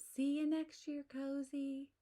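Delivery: high, squeaky calls of little animals; little animals calling out goodbye to Cozy; squeaky farewell voices. A narrator's reading. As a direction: call goodbye like tiny creatures. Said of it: "squeaky and growly and whistling voices".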